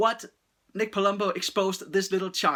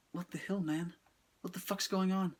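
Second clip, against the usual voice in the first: much softer, stunned aside; hushed disbelief; 9 dB quieter than usual. Retold or imitated. Reviewer reacting to the girl's killing, hushed disbelief. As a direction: say it under your breath, appalled. Retold appalled reaction to scene.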